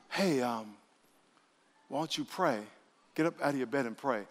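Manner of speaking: soft, low-key gentle nudge; small, still voice. Acted. Preacher voices God prompting a believer to pray, small still voice. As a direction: quietly suggest it, with no pressure. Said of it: "God's voice is a small, still voice".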